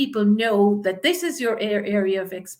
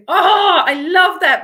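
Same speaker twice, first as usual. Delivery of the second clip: bursting out high and loud with delight; a viewer's comment makes the coach light up, delighted exclaim; 9 semitones higher, 9 dB louder than usual. Real, not acted. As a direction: gush over the comment like it made your day.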